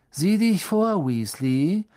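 high, loud sneer dropping on the name; taunting bully's jeer. A narrator's reading. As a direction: warn him with open mockery. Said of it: "höhnte Malfoy".

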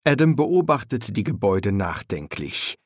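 ordinary delivery

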